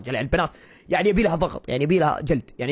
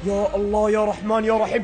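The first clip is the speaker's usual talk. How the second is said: usual speaking voice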